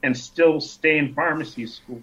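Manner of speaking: normal talking voice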